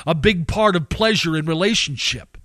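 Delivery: usual speaking voice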